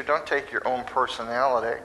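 normal talking voice

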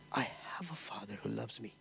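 an awed, breathless whisper to himself; hushed awe at being loved. Acted. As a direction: whisper it like a sudden private realization. Prayer realization, hushed.

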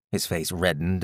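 plain reading voice

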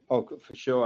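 normal talking voice

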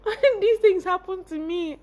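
high, anguished why me, breaking into laughs; wailing at fate, half laughing; panicked wail. Retold or imitated. To herself over her results, a panicked high wail.

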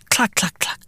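soft clucking chicken noise; chicken clucks. Retold or imitated. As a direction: cluck like a hen, quietly.

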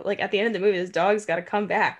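ordinary talk

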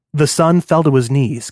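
usual speaking voice

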